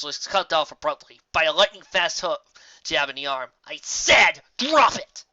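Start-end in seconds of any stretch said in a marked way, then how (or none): 3.6-5.2 loud snapped order, higher on drop; angry. Acted. Dash to Gilda after punching her, angry. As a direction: bark it as a final warning.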